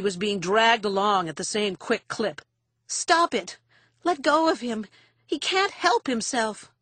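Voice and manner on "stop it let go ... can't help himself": high, urgent protest; frantic plea. A narrator's reading. Daughter begging for her father's release, frantic. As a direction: cry out to make him let go.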